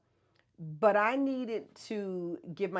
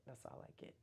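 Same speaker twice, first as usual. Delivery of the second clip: breathy aside to herself; whispered repeat; 5 semitones lower, 24 dB quieter, faster than usual. Shown as a demonstration. Repeating her limit to viewers in a whisper. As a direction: whisper the line, no voice.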